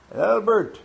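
name called out high and loud; calling out a name. Real, not acted. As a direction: call the name across the room. Calling out names from list.

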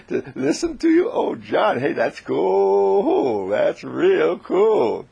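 high, loud, stretched-out "cool"; thrilled admiration. A narrator's reading. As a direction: whoop it slowly in amazement. Joel thrilled by John's power.

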